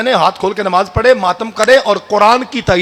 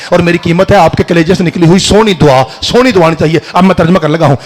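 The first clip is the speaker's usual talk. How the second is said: usual speaking voice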